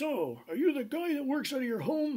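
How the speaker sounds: high, loud, put-on pompous lawyer voice; mock posh caller, pitched high; a caller's high voice. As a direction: ham up a self-important lawyer. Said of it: "And he goes".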